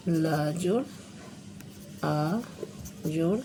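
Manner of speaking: slow, soft letter-by-letter breakdown; spelling it out. Shown as a demonstration. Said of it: "इसको अलग-अलग कर लेंगे".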